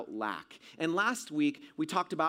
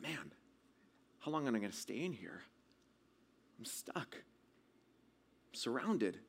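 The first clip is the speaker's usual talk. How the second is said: very soft, low, sinking voice; hushed fear; 4 semitones lower, 9 dB quieter than usual. Retold or imitated. Trapped boy alone with his thoughts, hushed fear. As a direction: think aloud quietly, losing hope, acting out dread. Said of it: "panic just went out to full out fear".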